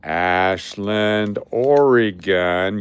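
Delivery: slow, drawn-out call; Ashland, Oregon dragged out slowly. Shown as a demonstration. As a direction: stretch each word out for a listener who missed it. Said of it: "I'll speak slower if that helps you".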